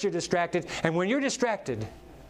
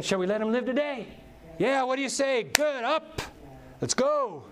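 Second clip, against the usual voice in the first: higher, cheery God voice; God waking you up; 9 semitones higher than usual. Retold or imitated. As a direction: rouse the sleeper brightly as God. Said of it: "I'll ask the question again".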